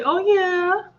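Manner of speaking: a high, excited "Oh, yeah". Shown as a demonstration. Streamer showing viewers how to cheer, high and excited.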